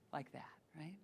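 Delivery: soft unvoiced whisper; hushed whisper to the room. Shown as a demonstration. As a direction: breathe it out without voice.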